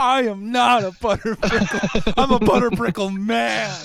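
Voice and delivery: loud high squeaky impression; high mocking voice. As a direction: mimic him in a shrill high voice.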